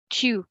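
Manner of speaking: Tu with rounded French u; Tu modeled for the class; French tu repeated. Shown as a demonstration. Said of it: "In French, we say".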